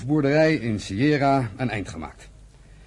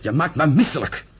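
disgusted. Acted. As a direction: spit out the disgust. Disgust at brother's refusal.

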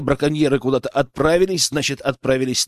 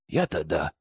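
low, soft, downcast reply; sad drawl; 11 semitones lower, 8 dB quieter than usual. A narrator's reading. Boyar assuring his companion he is all right, sadly. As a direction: answer mournfully, sinking low and quiet. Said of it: "печально протянул он".

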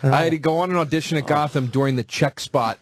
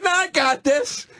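mock cocky; 13 semitones higher than usual. Cocky self-assurance quoted.

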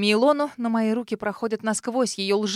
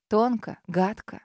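plain reading voice

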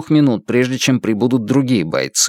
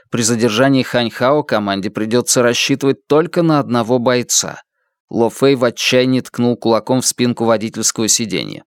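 usual speaking voice

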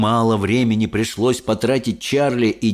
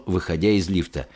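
ordinary delivery, nothing marked

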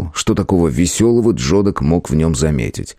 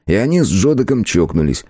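ordinary delivery

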